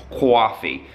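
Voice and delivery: Coffee as kwah-fee in a mock American accent; a quick, half-joking American Coffee. Shown as a demonstration. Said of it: "Never tell me to do a American accent".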